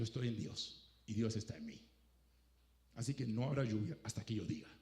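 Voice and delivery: hushed and certain, no rain until I say; quiet firm declaration. Retold or imitated. Preacher voicing Elijah, hushed and certain.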